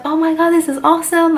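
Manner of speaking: high, loud gushing squeal; overjoyed shriek of delight; excited squeal. Retold or imitated. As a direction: burst out thrilled about the invite. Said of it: "I was like".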